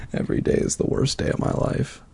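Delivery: put-on soft, breathy teen lament; mock whisper. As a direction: whisper it like a moody teenager. Mocking a gloomy teen.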